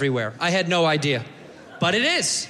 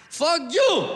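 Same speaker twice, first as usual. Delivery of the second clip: put-on high-pitched accented retort; hammed-up street-fight yell; mock angry yell; 8 semitones higher than usual. Acted street quarrel, answer thrown back.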